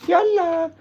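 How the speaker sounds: very high, loud sing-song call; high call to puppies. Acted. Owner calling the puppies in, high sing-song. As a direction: sing the dog in.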